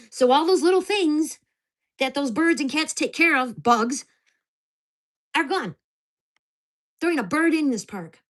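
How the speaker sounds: venting hard about the vanished birds; angry rant. Real, not acted. Ranting to viewers about the neighbors, loud and furious.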